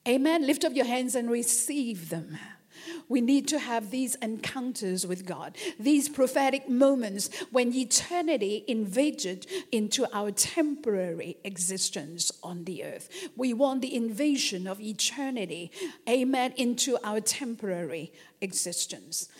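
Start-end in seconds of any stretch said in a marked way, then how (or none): none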